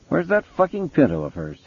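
high-pitched, testy character voice; irritated detective. A narrator's reading. Mason asking Townsend about the car, high and testy. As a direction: pitch it high, impatient for an answer.

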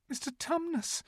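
very high, softish plea; distressed little girl calling his name; great distress. A narrator's reading. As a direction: plead anxiously in a high child's voice. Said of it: "said Lucy in great distress".

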